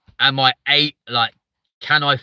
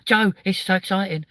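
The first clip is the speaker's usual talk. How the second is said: high-pitched, over-excited squeal of a celebrity impression; Stacey Solomon; 9 semitones higher than usual. Comic mimics a TV presenter thrilled with Joe, high excited gushing. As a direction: gush in a high voice with excited little ohs. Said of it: "Stacey Solomon".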